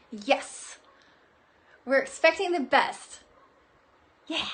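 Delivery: ordinary talk, nothing marked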